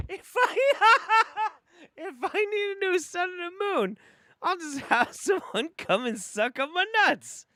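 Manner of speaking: high, giggling mock-quote through laughter; laughing while quoting. Real, not acted. Streamer repeating the clip's line to his chat, laughing hard. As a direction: squeeze the words out between laughs.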